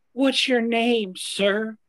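put-on high, slow young gal voice; high girl voice. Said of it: "she spoke out and said".

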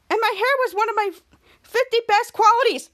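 high, loud lamenting voice; dramatic lament. Acted. Piper mourning one of her best qualities, lamenting. Girl laments ruined hair.

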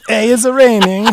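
put-on high, loud Borat-like Italian accent; squeaky mock Italian; Fabrizio impression. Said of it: "[name]'s impression of Fabrizio".

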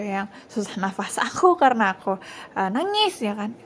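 normal talking voice

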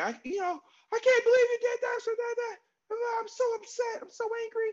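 hammed-up girlfriend venting; mock upset girlfriend. Girlfriend coming home after an argument, hammed-up high upset voice. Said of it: "she's like".

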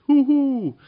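very high, loud, long whooping hoos; yelled hoots. A narrator's reading. Wizard calling down the chimney to goblins, yelled hoots. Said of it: "yelled the voice".

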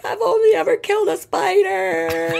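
high, slow, put-on little voice; mock whimper. Lying in bed telling her fiance she only killed spiders, high and whimpering. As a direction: whimper it like a guilty child. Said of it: "I was like".

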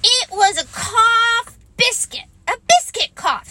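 put-on high, loud child voice; piping, insistent little one; protesting youngster. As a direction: explain it indignantly like a small child. Roo argues back in child voice.

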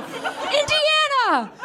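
high, drawn-out Indiana!; mock outrage. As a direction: stretch out Indiana in disbelief.

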